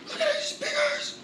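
high, laughing shriek of delight; giddy laughing outburst; excited squeal. Real, not acted. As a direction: squeal at the find. Showing off the speakers.

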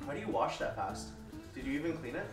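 ordinary talk, nothing marked

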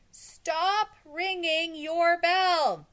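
very slow, high, loud holler; crowd shouting. A narrator's reading. Crowd shouts to the teacher ringing her bell, drawn-out holler. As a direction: bellow each word long to carry upward.

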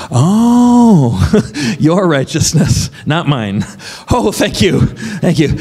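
high, laughing "oh" of sudden understanding; delighted realisation. Retold or imitated. The preacher recalls answering the Holy Spirit, surprised and laughing. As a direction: gasp it out with a laugh as it dawns.

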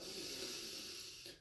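long soft exhale; quiet drawn-out breath out; breathing sigh. Retold or imitated. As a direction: let a slow breath out, acting relief. Acts out realizing he can breathe.

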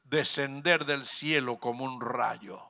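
low solemn voice of the Lord. Retold or imitated. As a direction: speak it gravely and hushed. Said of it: "qué le dijo el Señor".

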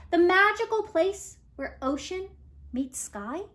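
ordinary delivery

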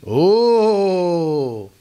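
long mock "oh", then high and laughing; gleeful shouter. Angry man delighted his opponent shouts back, gleeful. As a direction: relish finally having someone to fight. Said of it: "אם אתה עונה לו בצעקות".

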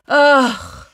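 loud, drawn-out groan; exasperated groan. Acted. Dreads the last page.

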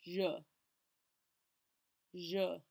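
je as a single clear syllable with an "uh" vowel; isolated French je, vowel "uh"; pronunciation model. Shown as a demonstration. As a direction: say je with the "uh" sound, on its own. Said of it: "focus on your pronunciation of this word".

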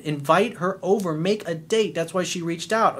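ordinary talk

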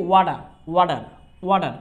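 ওয়াডার with the t as a quick ড; American flap t. Shown as a demonstration. Teacher sums up "water" for the class, flapped t. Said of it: "ডর মতো কিন্তু sound হয়েছে".

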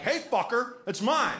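mock macho confrontation; butch macho challenge. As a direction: puff up and challenge him like a tough guy. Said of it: "whatever butch thing I'm supposed to do".

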